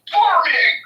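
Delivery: high, loud yelled catchphrase; shouted catchphrase. Pretend. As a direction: bellow it like a TV farmer. Yelling a show catchphrase.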